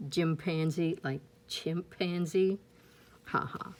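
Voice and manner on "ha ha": ordinary delivery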